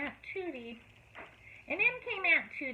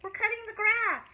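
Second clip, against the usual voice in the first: calling back; 10 semitones higher, 6 dB louder than usual. A narrator's reading. Children calling from yard.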